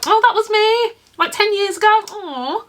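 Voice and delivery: high, cooing mock gush over an old memory; fond nostalgic gush. Looking back at old memories, a high fond coo. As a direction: coo like you just found an old photo.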